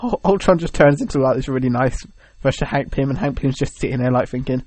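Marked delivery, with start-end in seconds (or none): none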